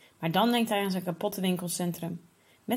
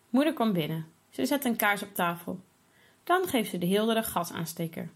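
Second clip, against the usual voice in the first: plain reading voice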